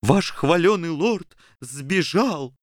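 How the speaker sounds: slow, high, gloating abbot; sneering priest. Acted.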